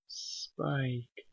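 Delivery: soft tearful murmur; through tears. Acted. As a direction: barely get his name out, crying. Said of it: "she said through her tears".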